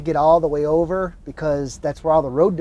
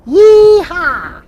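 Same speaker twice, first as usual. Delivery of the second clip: loud high cowboy whoop; fake cowboy accent; 13 semitones higher, 12 dB louder, much slower than usual. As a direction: holler it like a rodeo cowboy. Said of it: "Was that good?".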